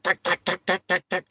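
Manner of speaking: high-pitched tack tack clicks; imitated ticking noise. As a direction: tap out the clicks up high, mimicking the vibration. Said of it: "making tiki noises".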